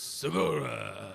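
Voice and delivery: slow, drawn-out mock Japanese accent; caricatured Japanese pronunciation of Segura. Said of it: "you're saying it Japanese".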